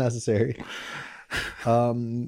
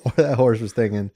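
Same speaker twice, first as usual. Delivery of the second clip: ordinary talk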